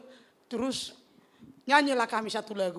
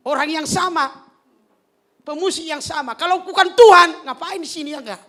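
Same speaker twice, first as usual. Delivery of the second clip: rising, forceful sermon delivery that swells loud on key lines; preaching; 6 dB louder than usual. Acted. Pastor exhorting the congregation, preaching.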